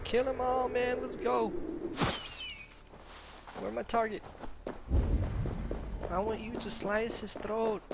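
rally yourself for the kill; pumped up. Real, not acted. Pumped-up battle talk.

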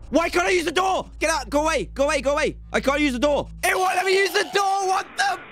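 desperate yelling as the door fails; panicked high shouting. Real, not acted. Door fails, monster in room.

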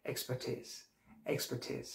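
এক্সপার্টিজ softly, ending "teez"; correct pronunciation. Shown as a demonstration. Teacher correcting their slip, soft "-teez". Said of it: "উচ্চারণটা হচ্ছে এক্সপার্টিজ".

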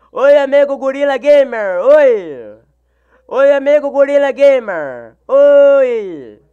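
bouncy shout-out to a viewer; exaggerated greeting. Real, not acted. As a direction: greet the fan like a cartoon host.

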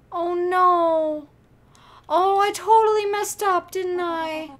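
loud, high wail of regret; distressed outcry. Real, not acted. Player realizes they messed up, loud dismayed cry. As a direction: cry out at your own mistake. Player reacts to game mistake.

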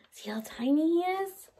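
soft whispered aside; a hushed aside to the class. Acted. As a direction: whisper about something tiny. Showing the tiny caterpillar.

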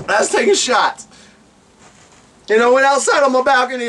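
ordinary talk, nothing marked

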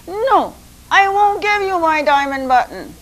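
small bird standing its ground; defiant little rooster. Acted.

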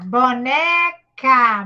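BONECA stretched slowly, syllable by syllable; sílaba por sílaba. Shown as a demonstration. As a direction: say "boneca" low and slow, in separated syllables. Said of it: "eu vou falar nas sílabas". ("boneca" devagar, separando bo-ne-ca)

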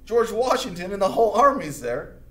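high, loud mock panic that peaks on stuck; hammed-up trapped general. Cornwallis finds himself surrounded, high loud mock panic. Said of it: "he's like".

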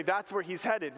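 usual speaking voice

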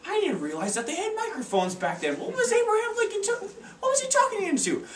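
very high, laughing bewildered voice; a baffled, giggling high-pitched question; confused parents. Retold or imitated. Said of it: "they'd be like".

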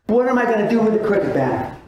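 usual speaking voice

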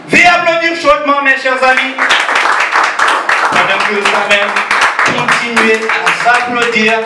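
high, pumped-up crowd call to cheer; hype MC call. Real, not acted. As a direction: emcee shouting the singer's name to the crowd. MC hyping crowd for next singer.

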